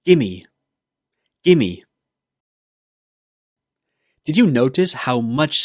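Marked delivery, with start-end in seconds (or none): 0.1-0.4 "Gimme" said as one word, no v sound; gimme demo. Shown as a demonstration. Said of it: "Say it after me". 1.4-1.8 "Gimme" said as one word, no v sound; gimme demo. Shown as a demonstration. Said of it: "Say it after me".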